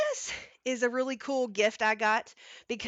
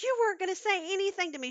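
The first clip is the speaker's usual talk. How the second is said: higher, fast mock scolding; teasing mom; 9 semitones higher, much faster than usual. Mom teasing Jeff, fast higher voice.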